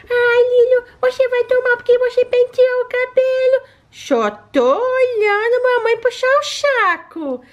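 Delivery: high pet voice, slow sulky tease; high pet voice, lightly mocking; teasing baby-voiced pet. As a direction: needle her sister like a jealous puppy.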